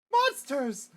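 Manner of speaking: high startled cry of fear; frightened shout. Acted. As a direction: yell it in panic. Sees monsters appear.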